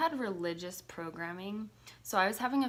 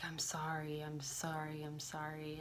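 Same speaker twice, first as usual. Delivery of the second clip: soft, fading whispered sorry; apologizing to mom while crying, softer and softer; tearful apology; 7 dB quieter than usual. Retold or imitated. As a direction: sob out sorry, softer each time. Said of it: "I just was like".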